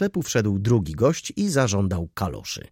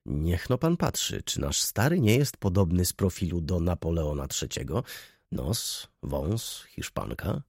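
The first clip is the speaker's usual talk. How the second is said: softer, confiding undertone; half-voice; 3 semitones lower, 5 dB quieter than usual. A narrator's reading. Clerk whispering a joke about the boss to a colleague, in an undertone. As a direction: lean in and say it under your breath. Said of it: "rzekł półgłosem".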